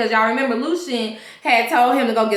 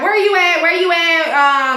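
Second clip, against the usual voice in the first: demanding boss; 5 semitones higher, 3 dB louder than usual. Retold or imitated. Boss radios missing rookie officer.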